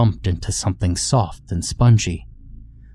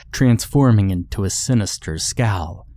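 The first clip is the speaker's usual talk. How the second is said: plain reading voice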